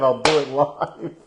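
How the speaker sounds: ordinary talk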